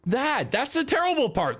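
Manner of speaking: high, huffy shopkeeper voice, indignant; high-voiced tea shop owner. Tea shop owner fuming about customers, high indignant voice. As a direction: play the outraged tea lady.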